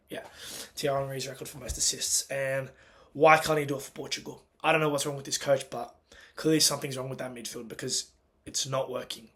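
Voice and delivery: ordinary delivery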